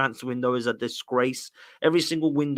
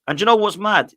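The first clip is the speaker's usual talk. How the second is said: fired-up outburst; 7 semitones higher, 7 dB louder than usual. Real, not acted. Fired-up rant about transfers.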